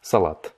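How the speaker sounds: salade ending in a clear T; Russian salade with T. Shown as a demonstration. Teacher repeating the Russian salade with a final T. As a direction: finish salade on a crisp T. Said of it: "c'est bien un T à la fin".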